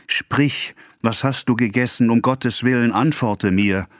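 high, desperate imploring; urgent pleading. A narrator's reading. Husband kneeling at wife's bed, pleading desperately. Husband begs dying wife to answer.

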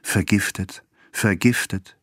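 stunned word, haltingly repeated; shocked repetition. A narrator's reading. Husband can utter only one word, stunned. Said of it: "konnte immer nur das eine Wort herausbringen".

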